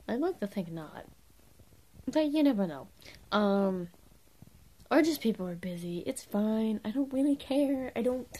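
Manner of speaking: usual speaking voice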